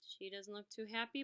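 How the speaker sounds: ordinary delivery, nothing marked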